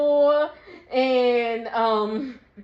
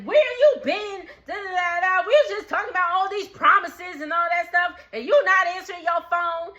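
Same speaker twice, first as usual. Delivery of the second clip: high, insistent mock tirade; confrontational rant; 5 semitones higher than usual. Brooke to J K about unanswered phone, confrontational. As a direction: imitate an angry girlfriend piling on.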